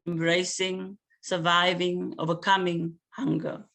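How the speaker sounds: poem reading. Acted. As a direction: weigh every word like an offering. Poet reads her poem aloud.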